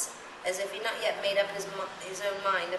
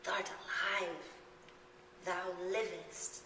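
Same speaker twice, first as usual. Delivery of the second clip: put-on soft, slow old-fashioned reassurance; soft comfort; 7 dB quieter, slower than usual. A narrator's reading. Boy comforts Coraline in a soft hush. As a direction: soothe her gently. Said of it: "he whispered".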